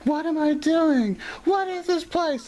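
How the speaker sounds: very high, drawn-out mock screaming; imitated yelling. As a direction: wail it like a panicking drunk girl. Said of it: "yelling, like".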